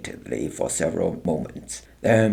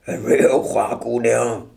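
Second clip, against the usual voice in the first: gloating soldier; 3 semitones higher than usual. A narrator's reading. Soldier gloats over Helen.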